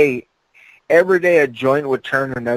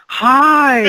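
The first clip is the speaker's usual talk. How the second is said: high, louder creepy "Hi!"; creepy doll hi; 11 semitones higher, 5 dB louder than usual. Retold or imitated. Quoting the creepy doll to friends, high, louder. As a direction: chirp a creepy doll greeting. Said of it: "when he goes".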